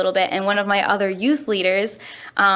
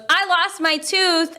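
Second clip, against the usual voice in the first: put-on proud kid; 8 semitones higher, slower than usual. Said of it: "And he goes".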